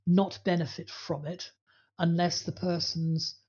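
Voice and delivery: normal talking voice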